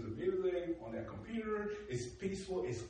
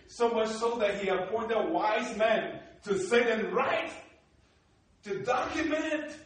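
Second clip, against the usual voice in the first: preaching emphasis; 6 semitones higher, 7 dB louder, slower than usual. Real, not acted. Preacher building to a climax.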